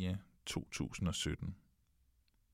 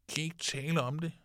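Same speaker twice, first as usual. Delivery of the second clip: put-on higher, quick childlike echo of dad's question; quick child echo; 7 semitones higher, faster than usual. The boys echoing their father's line back at him, quick and childlike. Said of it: "repeated by the children".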